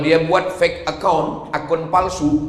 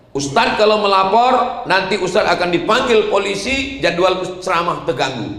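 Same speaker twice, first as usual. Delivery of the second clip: lightly voiced official, pitched higher; officer's warning; 6 semitones higher than usual. Police officer warns the preacher about reporting, lightly acted warning. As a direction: imitate a cautioning officer, raised pitch.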